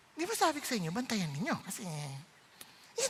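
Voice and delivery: soft, restrained mock scolding; hushed, sweetened-up reproach; suddenly controlled. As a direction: rein the temper in for the guest. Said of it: "bigla nakokontrol mo yung spirito".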